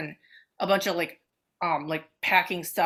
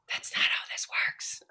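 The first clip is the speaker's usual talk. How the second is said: whispered; 6 dB quieter than usual. Retold or imitated. Whispered quote of the other.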